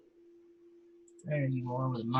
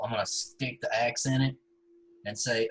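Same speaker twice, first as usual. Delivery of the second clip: ordinary delivery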